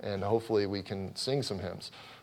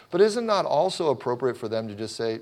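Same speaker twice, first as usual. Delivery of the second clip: ordinary delivery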